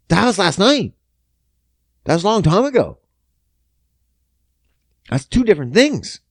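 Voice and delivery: high, emphatic arguing; exasperated protest. Retold or imitated. Man justifies himself to girlfriend, exasperated high protest. Argues back at girlfriend in acted quarrel.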